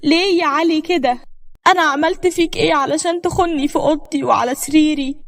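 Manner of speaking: high-pitched protest, only mildly screamed; anguished cry. A narrator's reading. Betrayed wife confronting her husband in their bedroom, anguished cry. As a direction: cry out the question in shocked hurt. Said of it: "صرخت وقلت".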